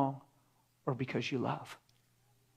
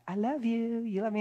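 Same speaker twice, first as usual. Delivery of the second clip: higher, sing-song mocking sweetness; mock Hollywood romance; 8 semitones higher than usual. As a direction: parody a cheesy movie couple. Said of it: "that old messy Hollywood thing".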